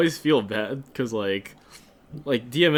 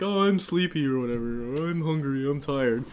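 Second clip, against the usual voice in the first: imitated students; 4 semitones higher than usual. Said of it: "And then they're like".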